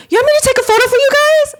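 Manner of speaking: high, fast, eager tourist-helper voice; eager stranger. Retold or imitated. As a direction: chirp it up like an excited stranger offering help. Lady offering photo, high fast.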